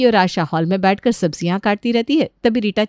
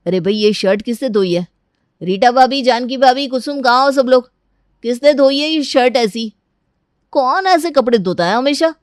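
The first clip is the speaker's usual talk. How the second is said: louder, raised voice calling the household; annoyed shouting; 4 semitones higher, 4 dB louder than usual. Acted. Brother-in-law calling everyone about his stained shirt, shouting in annoyance. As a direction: demand to know who did this. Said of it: "क्यों चिल्ला रहे हो".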